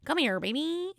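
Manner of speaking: a hint of the Kermit croon. Shown as a demonstration. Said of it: "I'm just gonna do the, the usual".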